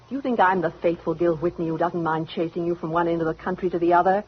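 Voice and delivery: ordinary talk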